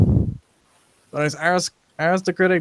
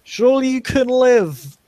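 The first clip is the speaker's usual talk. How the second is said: higher, slower, pleading with the crowd; exasperated leader; 7 semitones higher, 6 dB louder, much slower than usual. Acted. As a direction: slowly coax a complainer, pitched up. Rafik answers the heckler.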